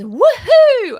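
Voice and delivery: a high, loud playful woohoo. Shown as a demonstration. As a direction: throw your voice out like a kid. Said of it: "We're gonna start with".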